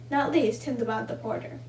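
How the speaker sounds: ordinary delivery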